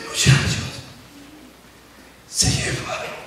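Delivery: hushed, secret whisper; whispering to the congregation about the theft. Acted. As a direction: lean in and whisper it.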